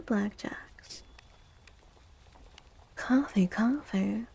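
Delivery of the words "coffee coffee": normal talking voice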